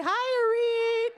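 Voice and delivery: high, slow, stretched-out hello; sing-song holler to a far-off friend; called-out greeting. Real, not acted. Host greeting a live stream viewer, high called-out hello.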